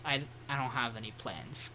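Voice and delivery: low, soft, small reply; meek admission. Retold or imitated. Podcaster voicing Rin admitting no vacation plans, low and meek. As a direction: drop it down to a sheepish mumble.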